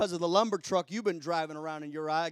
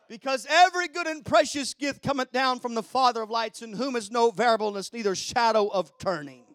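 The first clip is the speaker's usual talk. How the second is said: loud, raised pulpit delivery; emphatic, loud sermon voice; forceful preaching; 4 semitones higher, 10 dB louder than usual. Real, not acted.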